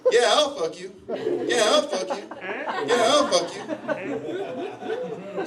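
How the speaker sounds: high, repeated robotic call-back; mock yelling. Runner repeating the call to the owl, high mock yell. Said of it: "I just keep yelling".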